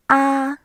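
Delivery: 啊 held high and level; first tone. Shown as a demonstration. Teacher demonstrating the first tone on 啊, high and flat. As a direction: say 啊 on one high, flat note. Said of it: "一声".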